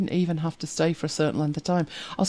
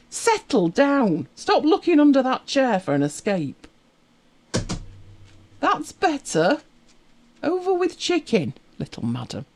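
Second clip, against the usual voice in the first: high-pitched pet talk; 7 semitones higher than usual. Real, not acted. Talking to her dog mid-stream.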